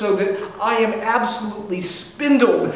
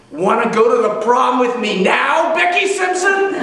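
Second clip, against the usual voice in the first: gloating taunt; 6 semitones higher than usual. Pretend. Gloating at his old crush.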